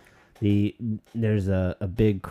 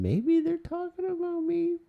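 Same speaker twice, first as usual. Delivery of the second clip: imagined quote. Retold or imitated. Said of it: "they can question".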